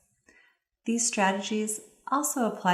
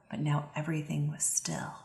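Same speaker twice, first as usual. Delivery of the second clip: soft, breathless whisper of stillness; hushed whisper; 2 semitones lower, 7 dB quieter than usual. Shown as a demonstration. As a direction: whisper as if afraid to break the silence. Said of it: "I'll show you an example".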